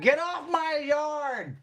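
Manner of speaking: put-on high, slow, cranky old lady yell; shouting at trespasser. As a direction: yell like a grumpy old woman. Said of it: "eighty-year-old woman".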